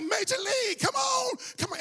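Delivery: high yelling from the stands; cheering shout. Retold or imitated. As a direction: yell him on from the bleachers, pitch up. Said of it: "And I'm like".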